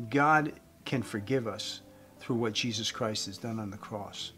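ordinary talk

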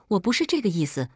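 plain reading voice

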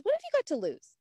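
lifted quoted challenge, high on what; quoting the lady. Retold or imitated. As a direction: sell it like a friendly saleswoman. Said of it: "Those were my magic words that day".

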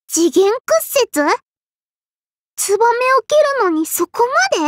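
high, incredulous questioning; shocked disbelief. Acted. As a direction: gape at it, pitch jumping up. Shocked by rival's technique. (驚きあきれた高い声)

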